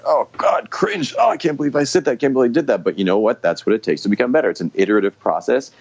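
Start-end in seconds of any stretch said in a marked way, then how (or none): 0.0-2.8 higher, squirming mock horror at your own video; mock cringing. Speaker watching their own talk videos, squirming mock horror. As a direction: act out wincing at yourself on video, pitched up. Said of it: "It's like".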